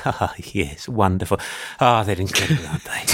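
low, hushed admiring South African voice; South African Keith. Retold or imitated. Caller asks about mice, Keith replies in awed South African accent. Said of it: "And he'd be like".

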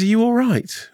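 high, questioning voice of a woman checking on a stranger; concerned young woman. Retold or imitated. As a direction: imitate a concerned passer-by. Joke's young woman asks concerned.

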